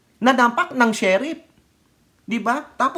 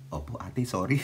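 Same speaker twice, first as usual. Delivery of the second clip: meek apology; 10 semitones lower, 9 dB quieter than usual. Retold or imitated.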